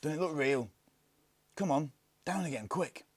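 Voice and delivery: urgent excitement. A narrator's reading. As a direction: hurry your friend back inside. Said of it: "exclaimed the Indian".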